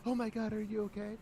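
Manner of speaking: very high, panicked gasp; mock panic. Parent panics at a fallen child, very high. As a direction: overreact to a child's fall. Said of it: "don't go panic".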